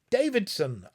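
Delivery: loud call of his name; an urgent loud call; cried out. A narrator's reading. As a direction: call him sharply to rouse him. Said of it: "I cried".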